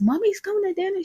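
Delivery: put-on high, delighted little-girl voice; Olivia. Little girl learns mommy is coming to dinner, thrilled. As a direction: imitate an excited child. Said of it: "and Olivia says".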